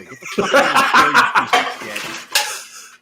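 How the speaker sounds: high, loud, slow telling-off; hammed-up furious bystander; mock outraged shout. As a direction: yell as an appalled onlooker. Imagined bystanders berating him.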